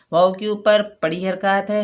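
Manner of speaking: ordinary delivery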